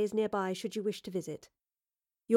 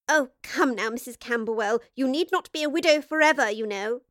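tittering; 3 semitones higher than usual. Acted. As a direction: titter it out, pitched well up. Grace voiced teasing.

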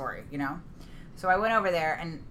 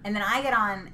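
ordinary talk, nothing marked

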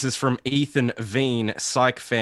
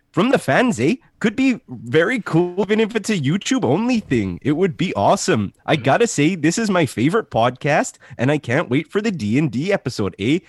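put-on pitched-up, sing-song Canadian accent; 6 semitones higher than usual. Host reads the fan's praise in a hammed-up Canadian accent. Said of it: "Okay, I'm back in".

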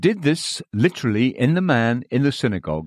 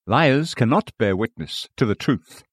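ordinary talk, nothing marked

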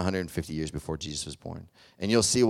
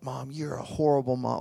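bitter accusation; 7 semitones higher than usual. Retold or imitated. High son accusing his crying mother, lightly acted. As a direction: lash out like a hurt teenager. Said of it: "and he's like".

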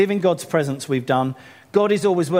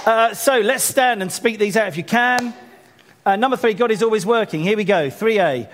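leading declarations; 6 semitones higher, 3 dB louder than usual. Acted. Pastor calls the church to declare together, rallying. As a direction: lift the room to stand and declare.

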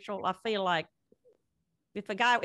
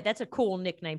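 ordinary talk, nothing marked

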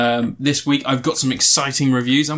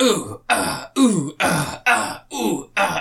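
hammed-up death groans; 6 semitones higher, much slower than usual. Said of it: "He just goes, he goes like".

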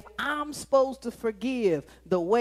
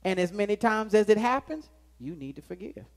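usual speaking voice

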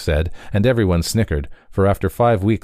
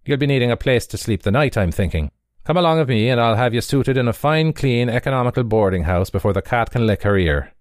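higher, fast, oily salesman patter; happy boarding-house runner; 5 semitones higher than usual. Acted. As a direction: reel them in with cheerful flattery.

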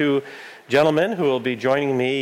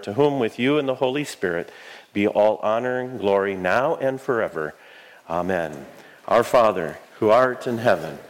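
prayer. Real, not acted. Recites eucharistic prayer.

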